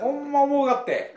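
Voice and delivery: emphatic Tosa dialect. Real, not acted. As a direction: speak up in local Tosa-ben. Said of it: "じゃあ土佐弁でやる気ね".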